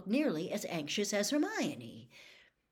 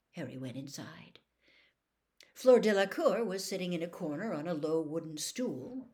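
ordinary delivery

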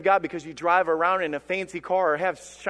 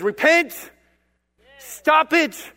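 high, forceful command; raised-voice preacher's rebuke; sharp rebuke; 7 semitones higher, much slower than usual. Acted. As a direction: order them sternly to stop. Voices God rebuking the church.

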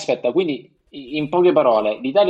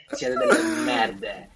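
high, slow mocking jeer; high mocking insult; 3 dB quieter, slower than usual. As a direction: squeal the insult slowly. Insulting politicians, acted.

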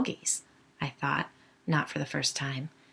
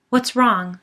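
worried call; 5 semitones higher, 9 dB louder than usual. A narrator's reading. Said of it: "worry in her voice".

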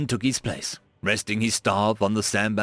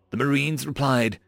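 ordinary delivery, nothing marked

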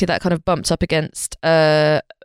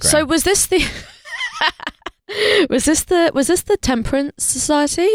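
laughing; 10 semitones higher than usual. Real, not acted. Laughing through question.

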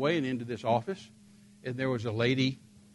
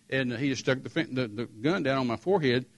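usual speaking voice

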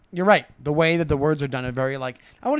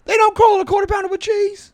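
high, loud disbelief; high astonished question; surprised; 8 dB louder than usual. Acted. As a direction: act out amazement. Jules amazed in acted scene.

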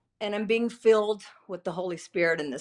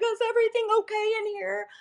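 much higher, fast, far voice of a concerned mother; worried mom; 14 semitones higher, faster than usual. Retold or imitated. Mom checking on her crying daughter, higher worried voice. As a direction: play the startled mom peeking in. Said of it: "my mom came into the room and was like".

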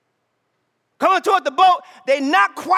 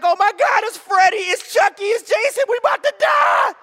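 high, panicky voice rising to a shout; hammed-up rising panic; mock terrified; 8 semitones higher than usual. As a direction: play up the frightened disciples. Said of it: "the disciples are terrified".

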